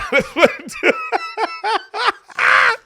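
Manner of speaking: loud high laughing fit; high burst of laughter. Real, not acted. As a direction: break into a big high laugh. Laughs after joke.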